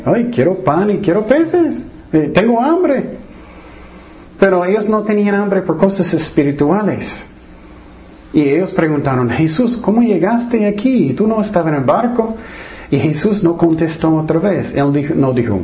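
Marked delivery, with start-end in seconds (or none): 0.0-3.2 high-pitched mock complaint of hunger; hungry crowd. Preacher imitates the crowd chasing Jesus for bread, mock hungry plea. 9.3-12.4 mildly puzzled, higher-pitched questioning; puzzled crowd. Acted. Preacher acts the crowd asking Jesus how he arrived, mildly puzzled.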